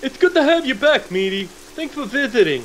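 high, cheery town voice; high welcoming voice. Pretend. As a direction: welcome him like a shopkeeper. Town answering back.

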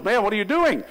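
lightly voiced nurse asking; curious nurse. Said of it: "the nurse goes".

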